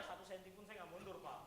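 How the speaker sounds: sudden drop to very soft; hushed refusal to back off; acted quiet defiance. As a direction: say it under the shouting, softly. Acts out standing firm after shouting.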